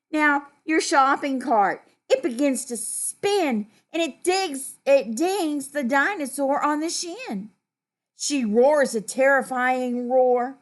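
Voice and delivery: put-on animated storytelling to children; story reading. A narrator's reading. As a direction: read it out brightly projected for little listeners.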